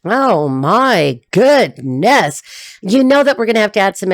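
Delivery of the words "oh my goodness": very slow, swooping exclamation; drawn-out amazement, word by word; astonished. Real, not acted. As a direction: stretch each word in a rising-and-falling wave.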